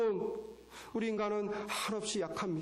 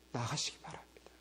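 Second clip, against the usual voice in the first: hushed whisper, much softer; sudden whispered call to come forward; whispered urging; 7 semitones lower, 6 dB quieter than usual. Real, not acted. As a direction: lean in and whisper the plea. Sudden hushed exhortation mid-sermon.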